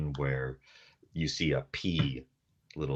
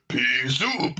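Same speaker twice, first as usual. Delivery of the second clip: louder, higher mock gruff beetle growl; 10 semitones higher, 7 dB louder than usual. Hefty beetle turns and growls, gruff. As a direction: bark it like a big hefty beetle. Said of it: "this real gruff voice".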